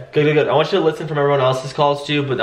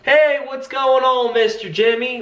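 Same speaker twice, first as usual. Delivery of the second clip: much higher, slow cheery greeting; imitating Alfred; 11 semitones higher, slower than usual. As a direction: stretch out a friendly hello. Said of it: "Alfred is like".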